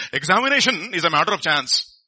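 imitated Telugu-accented pronouncement. Dad's old friend pronouncing on exams, imitated Telugu accent. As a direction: mimic an older man's Telugu accent, drawn on "Examination". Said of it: "in his own Telugu accent".

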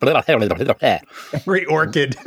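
drawn-out words through laughter; amused, laughing repeat. Real, not acted. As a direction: slowly echo the slip while cracking up. Laughing at the slip.

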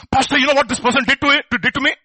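loud indignant tattle; a child tattling on someone, loud and aggrieved; loud tattling complaint. Retold or imitated. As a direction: run to the pastor complaining.

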